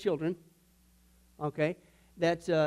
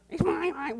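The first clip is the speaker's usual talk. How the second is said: much higher, whiny mine; whiny greedy child; 10 semitones higher, 3 dB louder, slower than usual. Acted. Preacher voicing a toddler hoarding a toy, high whine. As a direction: grab the toy and whine it's yours.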